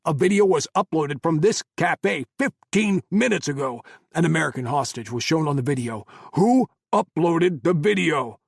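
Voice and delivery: loud, high angry interrogation; furious. Acted. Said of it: "team leader's fury".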